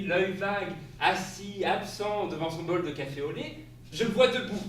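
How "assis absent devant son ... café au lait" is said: ordinary delivery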